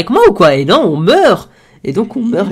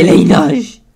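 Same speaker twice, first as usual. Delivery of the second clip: put-on drunk voice; 3 semitones higher, 7 dB louder than usual. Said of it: "je sais pas imiter le bourré".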